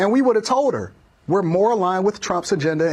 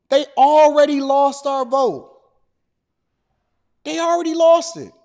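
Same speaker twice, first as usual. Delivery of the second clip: loud emphatic; 4 semitones higher, 4 dB louder than usual. Real, not acted.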